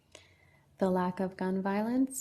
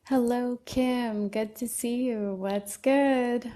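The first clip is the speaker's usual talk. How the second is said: excited greeting; 4 semitones higher, 5 dB louder, slower than usual. Real, not acted. Greeting a viewer on live stream.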